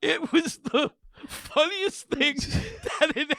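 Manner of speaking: high-pitched, laughing so hard it squeaks; cracking up. Real, not acted. Telling how they hit the floor, cracking up. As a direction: tell it while helpless with laughter.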